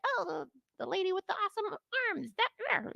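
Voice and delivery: put-on dreamy child. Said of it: "was like".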